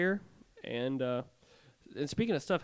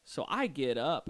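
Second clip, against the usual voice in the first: usual speaking voice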